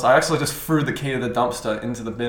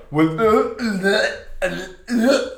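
slow, high stammering; stammering meme voice; 8 semitones higher, 3 dB louder, much slower than usual. Retold or imitated. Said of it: "the meme is like".

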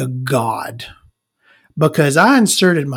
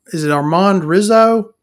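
ordinary talk

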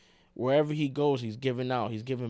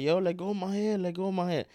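higher-pitched mock plea; imitated protest; 7 semitones higher than usual. Retelling a beaten rapper's cry, higher-pitched mock protest. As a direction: act out a mild, high protest. Quoting man being beaten.

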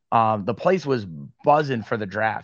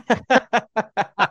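laughing; 10 semitones higher, 5 dB louder than usual. Real, not acted. Laughing at host's slip.

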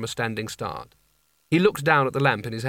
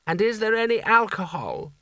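put-on high, languid old potentate; old sultan voice; 9 semitones higher than usual. Creosote voiced by narrator.

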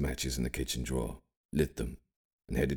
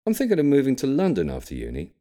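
put-on higher, louder young man's voice; young William; 11 semitones higher, 7 dB louder than usual. A narrator's reading. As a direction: voice the grandson, bright and eager. William's voice in story.

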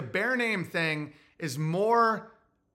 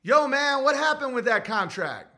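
raised, punchy challenge; acted-out slangy complaint; mock street confrontation; 6 semitones higher than usual. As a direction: demand it in street talk, pitched up. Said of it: "As soon as you start being like".

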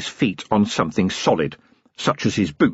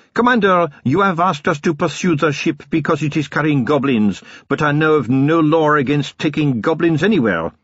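lightly raised formal officer; polite objecting officer; young lieutenant questioning the commander; 6 semitones higher than usual. A narrator's reading. Lieutenant voiced.

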